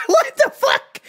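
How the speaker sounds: high, drawn-out incredulous laugh; laughing disbelief. Real, not acted. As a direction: cry it out in disbelief. Outraged at the lax pat-down.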